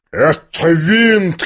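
high, very slow indignant cry; shouted accusation. A narrator's reading. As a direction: stretch it out as an outraged cry. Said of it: "кричал генерал". (протяжный гневный выкрик)

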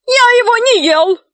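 high-pitched protesting yell; indignant high ogre cry. A narrator's reading. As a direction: shout a denial in a high voice. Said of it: "закричал людоед".

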